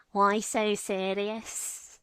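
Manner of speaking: put-on slow, drawn-out Scouse accent; Scouse Joker. Streamer retelling a silly meme, slow Scouse Joker. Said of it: "What if the Joker was Scouse?".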